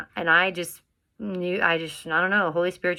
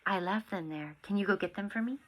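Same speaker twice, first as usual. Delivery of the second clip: soft aside; 11 dB quieter than usual. Real, not acted. Quiet aside to child.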